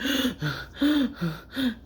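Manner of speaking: sharp high gasp for air; panic gasp. Retold or imitated. Reliving the panic attack with a sharp gasp. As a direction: gasp like the chest is locked tight.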